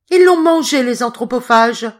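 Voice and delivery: put-on old lady's outraged cry. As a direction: shriek it in horror. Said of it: "s'écria la mère Michel".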